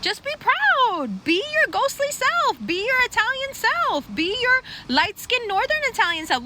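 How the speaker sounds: hammed-up cheerleading. Comic urging people to be proud, hammed-up cheering. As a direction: hype them up in a high, stretched voice.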